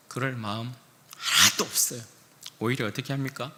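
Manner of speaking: normal talking voice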